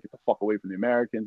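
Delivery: ordinary delivery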